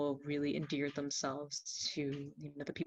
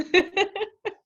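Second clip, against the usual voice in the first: high burst of laughter, much louder; real laugh; 9 dB louder than usual. Real, not acted. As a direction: laugh out at the coincidence. Laughing at the coincidence.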